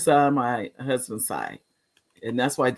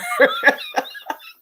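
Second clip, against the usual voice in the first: high laugh. Real, not acted. Host laughs at breaking her resolutions, high laugh.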